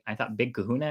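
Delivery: ordinary delivery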